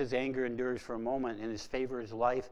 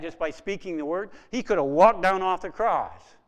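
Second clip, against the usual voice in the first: louder, higher proclamation; forceful preaching; 4 semitones higher, 8 dB louder than usual. Real, not acted. Preacher proclaiming to the church what Jesus could have done, loud. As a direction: thunder out his power.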